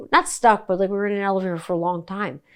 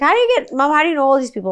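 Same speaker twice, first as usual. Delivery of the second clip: astonished son; 7 semitones higher, 5 dB louder, faster than usual. Retold or imitated. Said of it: "And he's like".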